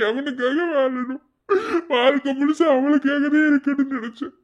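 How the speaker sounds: a husband sobbing for his dead wife; anguished crying. Acted. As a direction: howl out the grief. Grieving husband breaks down.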